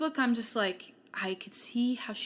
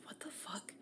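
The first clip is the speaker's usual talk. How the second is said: voiceless, stunned what the fuck; a hushed, stunned whisper; whispered disbelief; 19 dB quieter than usual. Retold or imitated. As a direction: breathe it out in shock. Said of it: "she's like".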